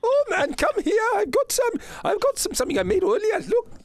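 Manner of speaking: high, excited merchant voice; eccentric merchant. Acted. Merchant presenting homemade jerky to adventurers, high excited voice.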